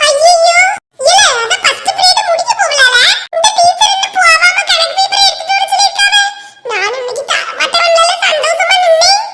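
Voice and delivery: put-on high-pitched child's voice, dismayed; high schoolkid whine of dismay. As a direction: complain to classmates like a let-down kid.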